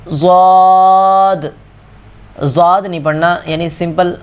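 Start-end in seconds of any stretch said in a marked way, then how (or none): 0.1-1.4 ض drawn long and heavy; ضاد full and held; heavy zwaad. Shown as a demonstration. Teacher reciting ضاد for students. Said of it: "دوسرا لفظ ہے زواد".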